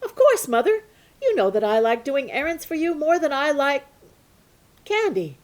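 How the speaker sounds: put-on high, bright-eyed boy's voice; a boy eager to please his mother. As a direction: play the keen young son, pitched high. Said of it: "And he said".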